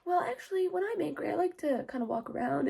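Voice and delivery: soft, high, mild voice; small unbothered voice with a laugh creeping in; oblivious little boy. Retold or imitated. Twelve-year-old answering a screaming group, soft and oblivious.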